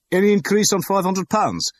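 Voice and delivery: loud, high call to the room; booming auctioneer. A narrator's reading. Auctioneer calls for bids.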